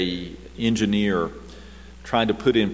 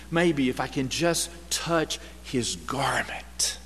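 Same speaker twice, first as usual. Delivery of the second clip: hushed hope; 2 semitones higher, 4 dB quieter, slower than usual. Acted. Woman's hopeful plea voiced.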